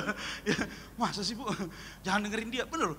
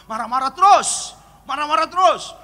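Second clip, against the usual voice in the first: acted exasperated complaint; 5 semitones higher, 10 dB louder than usual. Wife telling pastor of husband's temper, loud acted complaint. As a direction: complain loudly, like a fed-up wife.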